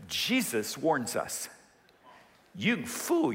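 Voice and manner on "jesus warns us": usual speaking voice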